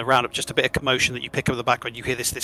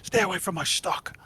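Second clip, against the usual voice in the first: gruff hobgoblin; 7 semitones higher than usual. Acted. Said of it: "has literally pushed him out".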